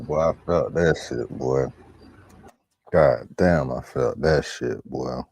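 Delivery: roaring approval at the joke; loud excited reaction. Real, not acted. Friend reacts to the quarterback joke with a loud hyped shout.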